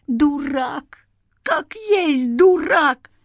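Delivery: high, wailing exasperation, drawn out; exasperated. A narrator's reading. Companion cursing a reckless man from behind, exasperated. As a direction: curse him high in helpless vexation. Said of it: "кто-то сплюнул в сердцах".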